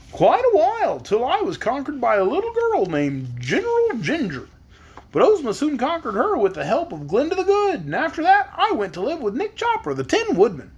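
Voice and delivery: cheery high Scarecrow. Acted. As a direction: pitch it way up like a stuffed scarecrow. Scarecrow answers.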